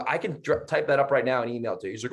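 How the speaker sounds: ordinary talk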